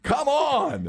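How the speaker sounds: high mock offended voice; hammed-up high bluster; fake indignation. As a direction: pretend you'd never miss it. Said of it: "And I'm like".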